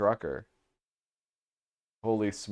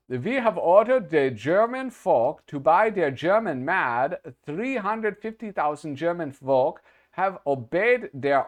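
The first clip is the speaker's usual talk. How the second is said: mock German accent, high and loud; 7 semitones higher, 5 dB louder than usual. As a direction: read it like a stern German official. Reading a German publisher's ad.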